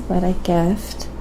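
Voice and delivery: ordinary talk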